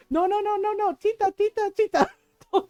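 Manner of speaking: high, alarmed cousin begging; mock panic, repeating words urgently; retold urgent pleading. Cousin begs tita to stop.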